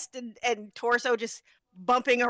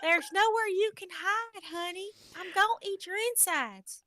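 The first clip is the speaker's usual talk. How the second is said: higher, cooing, menace under sugar; sweet monster threat; 8 semitones higher, 3 dB quieter than usual. Pretend. Co-host plays a sweet monster cornering prey, high and cooing. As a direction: tenderly promise to eat them. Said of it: "just sweet voice?".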